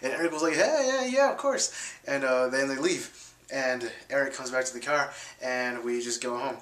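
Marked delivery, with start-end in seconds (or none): 0.5-1.7 much higher, eager mock agreement; a squeaky-keen yes; imitating friend. Friend agreeing to party with the scammer, imitated higher. Eric voiced accepting party invite.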